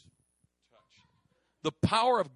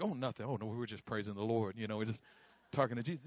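sheepish; 9 semitones lower, 5 dB quieter, faster than usual. Retold or imitated. Caught shouting, embarrassed excuse.

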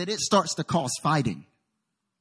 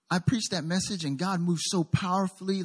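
ordinary delivery

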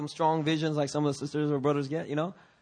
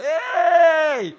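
high, loud, drawn-out yell; shepherd's long-distance call; 13 semitones higher, 10 dB louder than usual. Acted. Shepherd calling sheep that strayed far away, loud holler. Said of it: "the shepherd would just go".

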